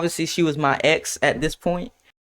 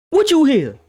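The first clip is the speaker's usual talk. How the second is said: louder and higher, instantly defensive; defensive snap; 9 semitones higher, 5 dB louder than usual. Retold or imitated. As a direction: fire it back on the defensive. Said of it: "why I get so defensive".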